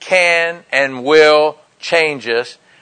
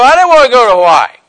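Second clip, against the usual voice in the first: higher, quick mock refusal; mock protest; 5 semitones higher, 4 dB louder, faster than usual. Preacher voices the objection dad never made, mock protest. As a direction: lightly imitate a reluctant Marine complaining.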